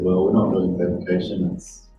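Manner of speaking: ordinary talk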